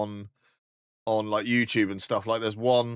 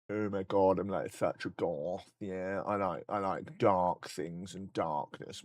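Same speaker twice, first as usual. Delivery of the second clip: mock emo; 4 semitones lower, 7 dB quieter, slower than usual. Mocking a goth emo fan.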